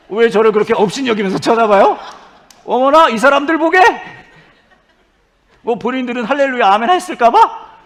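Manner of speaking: high, loud playful scolding; mock indignation. Preacher chiding the staring congregation, mock-indignant shout. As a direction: act affronted, shrieking at the crowd.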